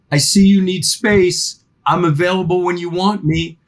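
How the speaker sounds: ordinary talk, nothing marked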